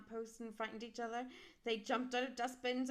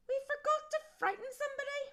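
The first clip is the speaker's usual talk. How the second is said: very high and loud, remembering; a sudden bright idea, squeaked high; big skeleton voice; 9 dB louder than usual. A narrator's reading. Big skeleton tells the little one, very high and loud. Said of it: "he said".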